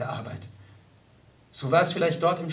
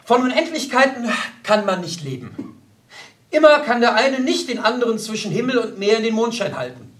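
higher, louder, declaiming a theory; lecturing; 5 semitones higher, 5 dB louder than usual. Acted. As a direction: pontificate with emphatic conviction. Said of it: "dozierte er".

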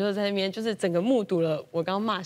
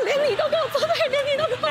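much higher, laughing manic rant; mock crazed laughing; 5 dB louder, much faster than usual. Mom stabbing feathers like a movie killer, laughing manically. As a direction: play a cackling crazy mom stabbing feathers. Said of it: "很像那个杀人魔".

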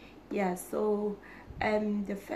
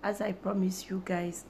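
ordinary delivery

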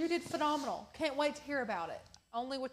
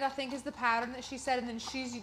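ordinary delivery

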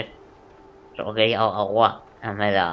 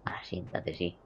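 whisper; 3 semitones lower, 13 dB quieter than usual. Real, not acted. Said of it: "unvoiced whisper".

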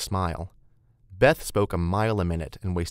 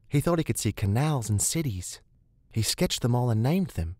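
put-on higher, lilting woman's voice, faint accent; Rebecca's faint accent, enthusiastic guide; 5 semitones higher than usual. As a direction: tell the history keenly, with a faint accent.

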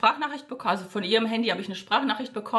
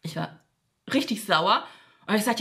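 ordinary delivery

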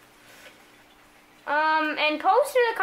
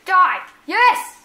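loud, higher battle yells; fierce gamer excitement; triumphant shout; 4 semitones higher, 9 dB louder than usual. Real, not acted. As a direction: shout it as the kill lands. Kills an enemy in game.

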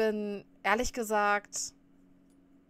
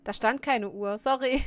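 usual speaking voice

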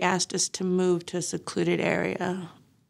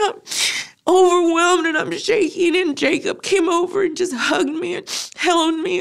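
voice breaking; 9 semitones higher, 4 dB louder than usual. Real, not acted. Recalling grief, voice breaking.

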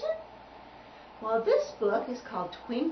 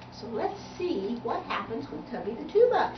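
usual speaking voice

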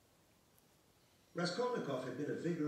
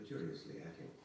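plain reading voice

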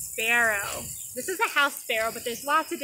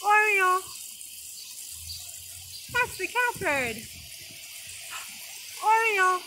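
calling out; 6 semitones higher than usual. Shown as a demonstration. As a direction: chant the bird name out. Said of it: "you're gonna go".

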